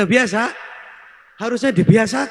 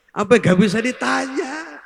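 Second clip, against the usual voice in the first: ordinary talk, nothing marked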